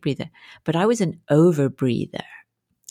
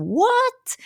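stunned disbelief. Retold or imitated. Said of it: "And I was like".

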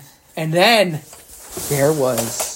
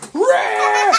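troll roar; 11 semitones higher than usual. Acted. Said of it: "And he's going".

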